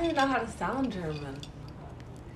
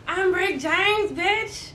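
drawn-out, raised comic catchphrase; Dave Chappelle; 5 semitones higher, much slower than usual. Acted. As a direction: stretch out the famous catchphrase. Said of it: "All right, Dave Chappelle".

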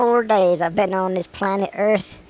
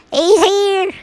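higher, louder hospital announcement; announcing nurse; 10 semitones higher, 6 dB louder than usual. Acted. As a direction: call out the birth. Voiced birth scene.

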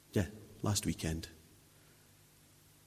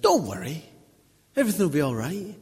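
bright, much higher and louder, sing-song; mock cheerful reassurance; 7 semitones higher, 7 dB louder than usual. Mocking easy comfort for a man losing his home, high and loud. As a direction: ham up a glib cheery brush-off.

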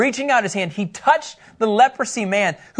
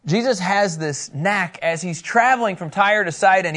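usual speaking voice

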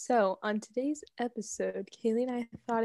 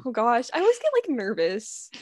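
ordinary delivery

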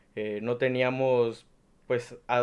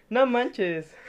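high, bright Mexico City slang exclamation; chilango accent; 10 semitones higher than usual. Retold or imitated. Imitating a Mexico City friend, chilango accent. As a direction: toss it off like a chilango. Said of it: "hablas mezclado entre dos acentos".

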